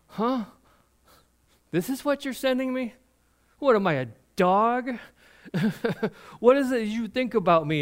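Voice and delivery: high, incredulous jeering giant voice; scoffing giant. Retold or imitated. Giant scoffs at the small soldier Israel sends, high and jeering. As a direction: sneer at the tiny boy they sent you. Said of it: "he goes".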